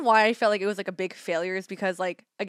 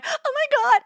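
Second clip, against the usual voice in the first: panicked exclamation; 7 dB louder, slower than usual. Retold or imitated. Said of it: "I think I already had my".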